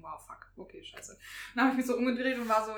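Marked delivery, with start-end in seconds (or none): 0.0-1.2 hushed curse. Retold or imitated. Retold inner reaction to a mistake.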